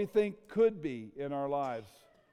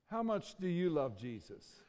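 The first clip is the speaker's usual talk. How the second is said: ordinary talk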